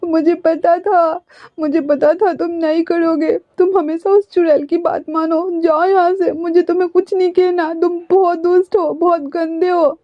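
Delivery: high, tearful sulking voice; crying child. A narrator's reading. Wing sobbing at Wangji, tearful and high. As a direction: sob out the complaints like a hurt child.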